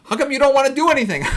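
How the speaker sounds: high, loud mock complaint; retold freak-out. Wife nagging her bookish husband, mock freak-out. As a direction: imitate an exasperated wife pitching it way up. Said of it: "like, freaked out".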